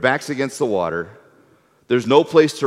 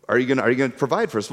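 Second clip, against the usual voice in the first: rushed demand; much faster than usual. Retold or imitated. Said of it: "what the people then say".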